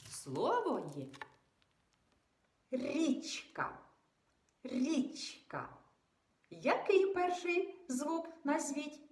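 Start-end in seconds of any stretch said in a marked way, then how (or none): none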